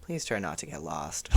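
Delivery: flat, dry warning from a strict teacher; stern teacher. Retold or imitated. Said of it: "But Mister Farouk's like".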